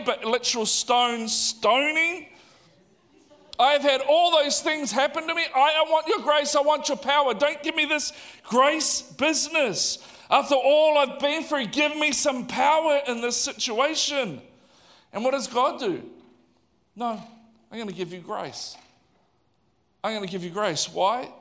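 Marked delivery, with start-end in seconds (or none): 3.6-14.4 high, exasperated demand; Paul. Acted. Paul demanding power after all he's been through, exasperated. Acting Paul's protest to God. 17.0-20.9 soft, measured answer after an outburst; calm refusal. Acted. God answering Paul's demand for power, quietly firm.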